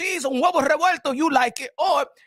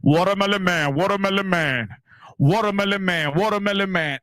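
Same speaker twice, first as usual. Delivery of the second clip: mild imitation of a vendor's loudspeaker cry; imitating street vendor; 8 semitones lower than usual. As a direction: call out like the man on the van loudspeaker. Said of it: "in the loudspeaker".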